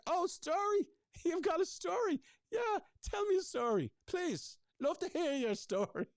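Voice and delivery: a sarcastic send-up of eager interest, pitched high; mock eager. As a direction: ham up fake delight at hearing a story. Mocking an eager partner.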